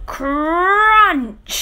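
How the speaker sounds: high, loud crunching sound word. A narrator's reading. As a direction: belt it out like wood breaking. Pram flattened.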